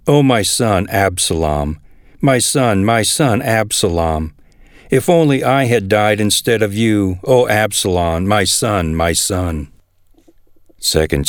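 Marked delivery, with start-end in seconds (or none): none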